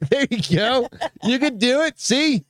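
high, lilting cheer-on; high-pitched encouragement. Real, not acted. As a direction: egg them on in a high, sing-song lilt. Cheering on the accent attempt.

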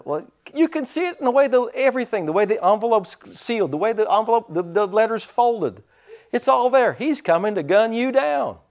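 high, worked-up deputy voice; Barney's alarm. Retold or imitated. Deputy frets to the sheriff, high and worked-up. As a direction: play the nervous deputy reading clues. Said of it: "And so Barney's like".

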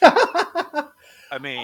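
high, loud laugh on the words. Real, not acted. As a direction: laugh through it.

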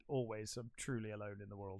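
low, hushed imitation of a lonely woman; retold forlorn reply. Woman answering the man hitting on her, imitated forlornly.

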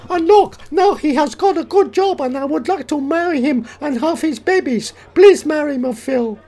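high, loud mock Japanese woman; female Japanese psychiatrist. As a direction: plead in a put-on Japanese falsetto. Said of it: "Female Japanese psychiatrist".

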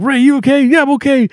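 high-pitched calling to check on each other; concerned teammates. Retold or imitated. The team checking who survived the explosion, concerned. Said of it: "and they're like".

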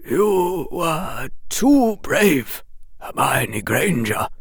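put-on slow, gentle old headmaster. A narrator's reading. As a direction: praise her gently and clearly. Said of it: "His voice was gentle and clearly audible".

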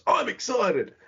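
high, excited ad voice, imitated; Big Kev catchphrase. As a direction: mimic the cheesy ad pitchman, pitch up. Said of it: "his catchphrase was".

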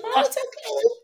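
put-on high, breezy little girl; little daughter's voice. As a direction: brush it off like a small child. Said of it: "She's like".

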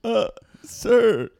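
high, loud recruit reply; barked sir yes sir. Pretend. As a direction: bark it back like a recruit. Recruit answering drill sergeant.

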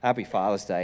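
soft, low, tender wish; tender greeting. Retold or imitated. Pastor hugging new dad Levi, soft and low. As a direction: say it softly while hugging a friend. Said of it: "gave him a hug and just went".

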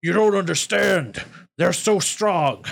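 retreating goblin soldier's worried plea; hobgoblin underling. Acted. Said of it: "He's talking to his leader and he says".

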